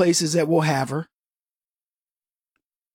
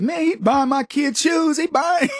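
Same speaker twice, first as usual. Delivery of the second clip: indignant outburst; 8 semitones higher than usual. Retold or imitated. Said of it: "I was like".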